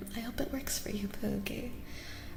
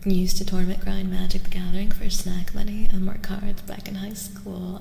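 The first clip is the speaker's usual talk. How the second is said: ASMR whisper; 12 dB louder than usual. Acted. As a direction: close whisper reading a comment. Reads chat message in whisper.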